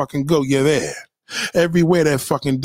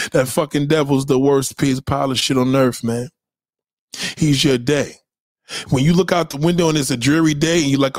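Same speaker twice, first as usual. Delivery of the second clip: usual speaking voice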